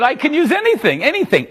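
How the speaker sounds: eager boast. Retold or imitated. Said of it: "I said".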